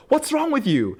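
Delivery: high-pitched mock exasperation; [name], exasperated. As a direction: throw it out as an appalled question, pitched right up. [name]'s acted exasperation at Klaus.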